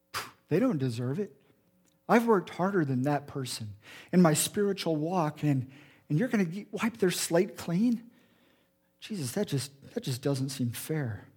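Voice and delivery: a quiet, sulky protest of unfairness; resentful complaint. Retold or imitated. Preacher voicing his own petty complaint to Jesus, soft and sulky. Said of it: "in my life when I say".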